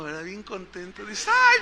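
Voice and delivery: normal talking voice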